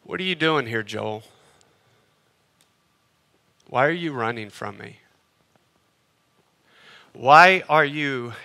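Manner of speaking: soft, hushed, tender questioning; voice of God. Acted. God gently asking a runaway believer why he hides, soft and hushed. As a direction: speak it low and gentle, like a still voice. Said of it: "the Lord is speaking to you today and say".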